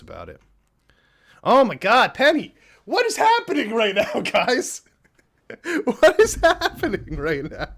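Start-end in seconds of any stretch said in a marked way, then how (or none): 1.4-7.7 high, loud disbelieving squeal; excited shriek. Real, not acted. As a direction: shriek with stunned delight at the gift. Screaming at huge donation.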